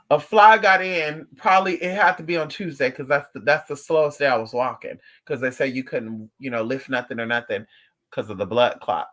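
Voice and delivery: ordinary talk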